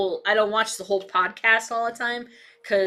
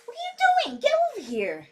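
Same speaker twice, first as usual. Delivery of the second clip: much higher pet-scolding voice; high scold to dog; 13 semitones higher, 3 dB quieter than usual. Real, not acted.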